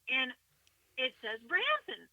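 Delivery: normal talking voice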